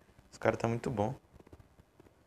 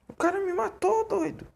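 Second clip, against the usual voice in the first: shocked yell. Real, not acted. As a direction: yell it in disbelief. Killed in game, yells.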